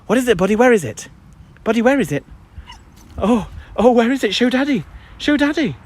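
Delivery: high, sing-song voice for the dog. Real, not acted. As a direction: coax the dog in a high playful voice. Baby-talking to the dog.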